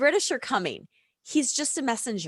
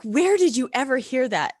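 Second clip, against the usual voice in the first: louder, incredulous question; startled mom; 5 semitones higher, 6 dB louder than usual. Retold or imitated. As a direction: ask it like you can't believe your ears. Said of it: "And I was like".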